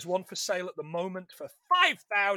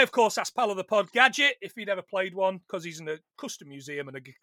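usual speaking voice

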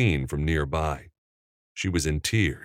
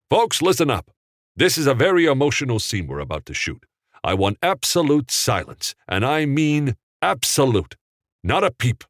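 raised, higher voice across the set; director calling out; 8 semitones higher than usual. A narrator's reading. Capra demands absolute silence before the emotional scene, raised voice. Director calls cast and crew.

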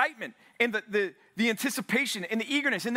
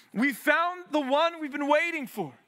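excited; 5 semitones higher than usual. Acted. Andrew announcing the Messiah.

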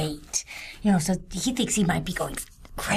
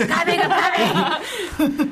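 laughing call; 11 semitones higher, 3 dB louder than usual. Real, not acted. Calling out, laughing.

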